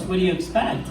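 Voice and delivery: normal talking voice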